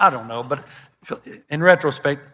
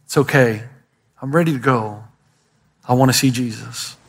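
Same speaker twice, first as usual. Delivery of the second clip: dying person's words; 7 semitones lower than usual. Retold or imitated. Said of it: "I hear the same message".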